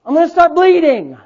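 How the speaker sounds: high, loud, hammering sermon; fired-up preaching. Real, not acted. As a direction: preach hard, punching the key phrases. Preaches at full force.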